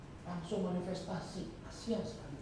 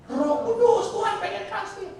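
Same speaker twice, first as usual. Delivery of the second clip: much higher and louder; fervent cry; 12 semitones higher, 11 dB louder than usual. Real, not acted. Preacher crying out to the congregation with fervour. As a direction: lift it up with fervour.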